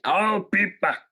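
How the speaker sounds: put-on action-hero voice; bad film impression. Host repeats film clue, put-on hero voice. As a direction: say it as a rough movie tough-guy impression. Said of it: "if I did the real impression".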